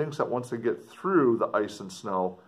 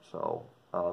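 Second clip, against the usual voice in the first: ordinary delivery, nothing marked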